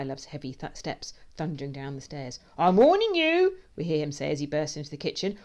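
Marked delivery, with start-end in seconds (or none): 2.6-3.6 slow, high, loud threat; warning shout. A narrator's reading. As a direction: bellow a comic threat. Man threatens intruder.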